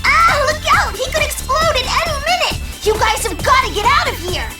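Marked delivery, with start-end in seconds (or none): 0.1-2.9 high, panicked cry; urgent, high-pitched alarm; alarmed warning. Acted. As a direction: warn everyone of an imminent blast. Announcer warns of explosion.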